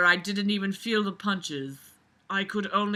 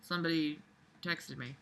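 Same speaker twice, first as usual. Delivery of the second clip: ordinary talk, nothing marked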